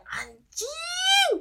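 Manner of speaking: very high, long-held squealed curse; hammed-up high-pitched shriek; mock scream. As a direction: re-enact screaming on a plunging thrill ride. Re-enacting scream on the drop ride.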